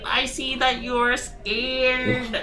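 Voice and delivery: high sing-song friendly voice; sweet high voice with a threat; Mister voice. Pretend. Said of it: "should I use my normal voice, my Mister voice".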